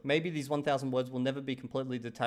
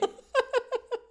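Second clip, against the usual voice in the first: high laugh. Real, not acted. Laughs at own joke.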